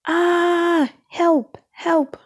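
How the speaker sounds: high, panicked cries for help; trapped and yelling for help; crying for help. A narrator's reading. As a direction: call out in fright from the fire. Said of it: "cried Lightning".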